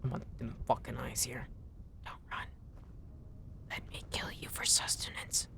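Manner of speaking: creepy hushed whisper. Real, not acted. Hunter to the fox she stalks, whispering.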